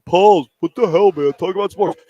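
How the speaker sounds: hammed-up annoyed fan demanding sports; mock fed-up listener. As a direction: bark it like a caller sick of the off-topic chat.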